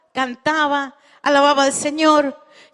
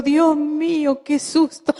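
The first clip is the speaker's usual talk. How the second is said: susto retratado; slower than usual. Retold or imitated. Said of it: "yo estaba así como diciendo".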